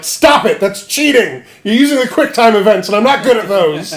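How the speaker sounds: mock whiny protest. Guts losing to Griffith, loud whining. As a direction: whine at the cheater.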